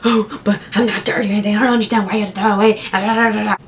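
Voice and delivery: fast, offended rush of words; talking fast and upset like the customer. Retold or imitated. A customer upset over her spoon, talking fast and offended. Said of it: "she literally got so upset".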